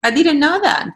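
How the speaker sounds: high, surprised repeat of the news; really didn't know that. Real, not acted. Host to guest about Frisian, openly surprised.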